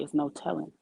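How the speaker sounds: usual speaking voice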